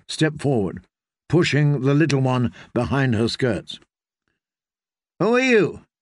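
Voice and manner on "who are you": put-on sharp high challenge from a girl; higher-pitched, demanding question. A narrator's reading. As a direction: demand to know who they are, pitched high. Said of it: "she demanded".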